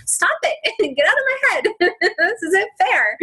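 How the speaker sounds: high, laughing get-out-of-my-head plea; real giggling protest. Real, not acted. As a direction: laugh it off, caught out. Teased by mentor, laughing protest.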